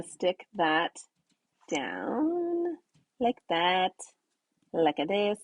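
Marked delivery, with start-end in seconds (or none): 1.7-5.4 a hammed-up Italian accent, lightly done; a jokey stab at an Italian accent, pitch jumping up on down; fake Italian accent. Stamper pressing her card down, playing with a fake Italian accent. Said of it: "my, like, fake Italian accent".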